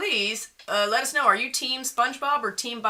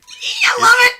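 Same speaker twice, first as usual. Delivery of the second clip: high, loud burst of delight; delighted squeal; 11 dB louder, slower than usual. Real, not acted. Listener thrilled by the radio voice, delighted squeal.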